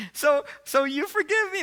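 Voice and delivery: high, loud mock-female plea; imitated hopeful woman. Fiancée checking he forgives her, high hopeful imitation. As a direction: squeak the question as her.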